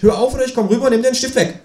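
high, fast mock threat; threatening kangaroo. Kangaroo threatening the writer, high and quick.

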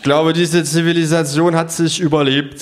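hohe, angeekelte Klage; hoch und angetrunken verkünden; betrunkene Verkündung. Acted. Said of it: "sag ich". (angewiderte, betrunkene Verkündung)

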